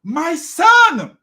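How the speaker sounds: loud, high-pitched hail; calling out. Acted. Father spotting his son at a distance, calling out. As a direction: holler it high to someone at a distance. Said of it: "Call out to him".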